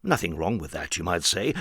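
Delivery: usual speaking voice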